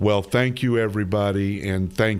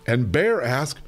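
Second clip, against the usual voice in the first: plain reading voice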